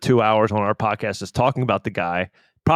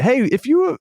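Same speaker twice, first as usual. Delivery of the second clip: retold pitch; 12 semitones higher, slower than usual. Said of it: "we did say".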